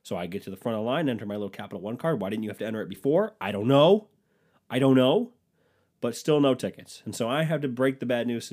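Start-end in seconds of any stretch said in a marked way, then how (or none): 3.4-5.3 exasperated. Acted. Exasperated repeat, loud.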